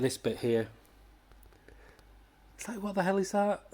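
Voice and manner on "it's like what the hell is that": incredulous outburst. Real, not acted. Reacting in disbelief to a panel.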